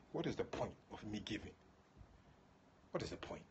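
quiet doubting question, dropping low; soft discouraged mutter. Retold or imitated. Discouraged giver asking why give, quiet and low.

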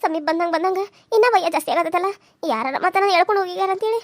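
usual speaking voice

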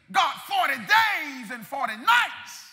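loud, slow wail to God; anguished cry to God. Acted. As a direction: cry out in prayer, drawing out each stressed word. Preacher acts out anguished prayer.

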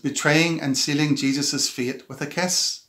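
normal talking voice

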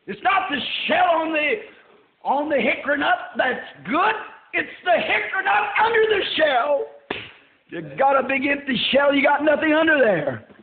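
high, punched sermon delivery; loud, fervent preacher pitch; full-force preaching. Real, not acted. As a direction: hammer the point home to the congregation.

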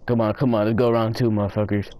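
ordinary talk

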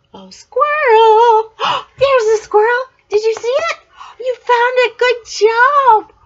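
high and loud, thrilled to spot it; delighted discovery, up high; excited. Real, not acted. Grandma cheering as the child finds the squirrel.

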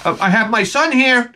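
mildly huffy fatherly rebuke; indignant dad. Retold or imitated. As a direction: protect your son from the swearing. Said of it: "I'm like".

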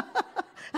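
short breathy laugh; a quick airy laugh. Real, not acted. Pastor laughing at his own joke, airy.

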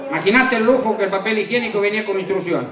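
normal talking voice

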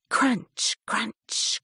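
plain reading voice

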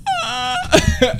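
high squeaky laugh out of the fake cry; breaking into laughter. Real, not acted. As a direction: crack up mid sob, high and squeaky. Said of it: "does this crying voice sound believable".

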